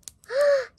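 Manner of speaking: loud, high gasp; sharp gasp. Real, not acted. Fan opening an album, sharp gasp. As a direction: gasp hard in surprise.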